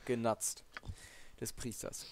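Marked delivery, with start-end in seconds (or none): 1.4-2.1 whispered aside. Real, not acted. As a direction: breathe it out under your breath. Repeating the word under breath.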